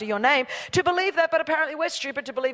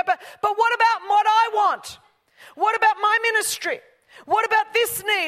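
whiny churchgoer; 12 semitones higher, 8 dB louder than usual. Retold or imitated. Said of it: "And then we go".

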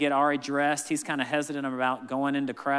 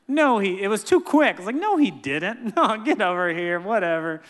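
laughing protest; 5 semitones higher, 5 dB louder than usual. Retold or imitated. Dad reacting to poop news.

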